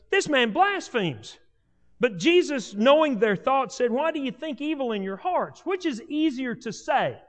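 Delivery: scripture reading. Acted. Preacher reading Matthew nine to his church, proclaiming. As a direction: read the gospel passage with weight.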